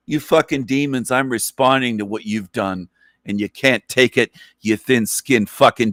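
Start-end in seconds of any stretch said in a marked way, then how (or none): none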